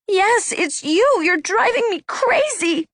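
high-pitched angry exclamation; exasperated outburst. A narrator's reading. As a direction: cry out at the end of your patience. Anna's angry outburst at her sister.

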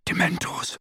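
breathless near-silent mouthing; hushed breathless Dementors; mouthed word. A narrator's reading. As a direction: barely voice it, out of breath. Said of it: "mouthing the single word".

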